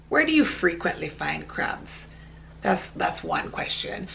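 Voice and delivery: low, softly posed rhetorical question; pointed quiet aside. Real, not acted. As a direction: drop low and soft to make the point. Sarcastic rhetorical aside in argument.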